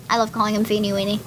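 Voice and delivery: ordinary delivery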